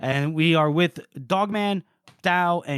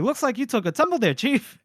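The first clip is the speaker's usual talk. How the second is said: high, quick mocking sing-song; sarcastic taunt; 8 semitones higher than usual. Dao mocking a limping Dogman, high quick sarcastic jab. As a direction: needle him for falling, fast and high.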